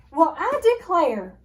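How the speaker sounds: loud, slow offended gasp; old lady aghast, loud and slow; shocked gasp. A narrator's reading. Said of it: "Miss Tussleberry gasped".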